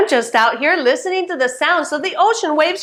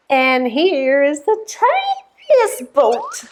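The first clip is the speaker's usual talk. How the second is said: tiny voice; slower than usual. Pretend. Voices tininess in pretend rhyme.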